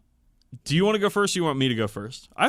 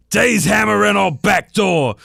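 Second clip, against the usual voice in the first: screeching orc voice, higher and louder; 8 semitones higher, 9 dB louder than usual. Acted. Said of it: "screeched the squawker box".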